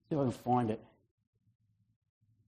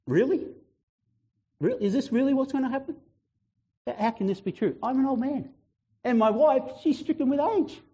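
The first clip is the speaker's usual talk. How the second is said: incredulous; 6 semitones higher than usual. Acted. Acting out Zechariah doubting the angel.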